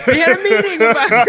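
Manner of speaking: high, loud, laughing through it; laughing shout. Pretend. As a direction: shout it while cracking up. Laughing repeat of callback.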